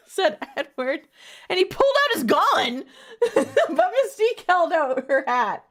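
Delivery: high, loud narration through real laughter; laughing while reading. Real, not acted. Reader narrates Edward's standoff, cracking up laughing. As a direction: struggle to read on while cracking up.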